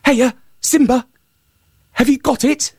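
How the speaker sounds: high hare calling out. A narrator's reading. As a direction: call to the lion from outside as the hare. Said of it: "the hare came back and called".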